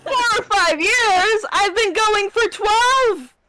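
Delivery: high, loud, slow indignant cry; outraged disbelief. Real, not acted. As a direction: stretch it out in disbelief. DM outraged at suggestion.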